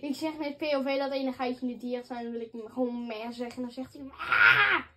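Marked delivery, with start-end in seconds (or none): 4.0-4.8 a goat's shouted bleat, like a ram. Retold or imitated. De gamer doet zijn geit na met een schreeuw als van een ram. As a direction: yell "Ah!" like a ram. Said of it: "ik klink wel als een ram".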